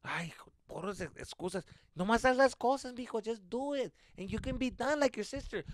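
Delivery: a hint of a mom nagging her son to clean; scolding mom.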